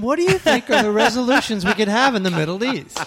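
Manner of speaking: high, lightly voiced beauty queen; poised pageant answer. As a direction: play a pageant contestant asking politely. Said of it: "talks like a beauty queen contestant".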